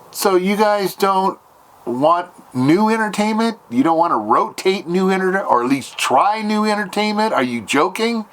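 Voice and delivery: high, exasperated disbelief; irritated. Retold or imitated. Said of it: "And I said it kinda like that".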